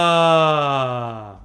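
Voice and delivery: long, loud open sigh on ah; relaxed sigh. Shown as a demonstration. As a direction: let the breath fall out freely on a sigh. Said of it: "let's do three more".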